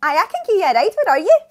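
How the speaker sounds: bright, loud call-centre greeting; loud phone voice. Acted. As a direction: belt it down the phone line. Answering a taxi phone call.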